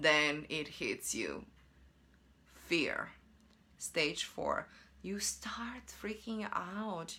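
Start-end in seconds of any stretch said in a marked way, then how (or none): 5.0-7.2 slow, breathy whisper of dread; hushed whisper. Acted. Explaining fear stage to viewers, slow hushed whisper. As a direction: let it slip out as a scared whisper.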